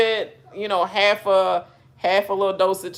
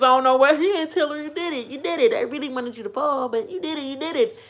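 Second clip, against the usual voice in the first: raised pitch, softer insincere praise; fake congratulations; 4 semitones higher, 3 dB quieter, faster than usual. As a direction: imitate a phoney congratulating hug. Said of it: "Fakest hug".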